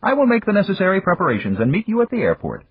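high, measured executive voice; Japanese businessman voice. Acted. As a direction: voice him high and formal. Yamagata speaking in story.

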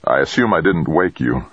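ordinary delivery

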